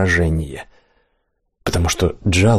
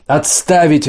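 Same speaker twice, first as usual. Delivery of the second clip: barked order; 7 semitones higher, slower than usual. Acted. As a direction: bark the order to stop. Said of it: "рявкнул командир".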